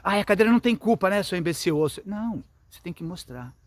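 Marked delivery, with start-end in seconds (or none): 0.0-1.8 higher, louder, fast mocking scold; harsh scolding. Shown as a demonstration. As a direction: snap at the child with contempt. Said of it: "não pode fazer assim". (bronca irritada e debochada, mais alta e aguda)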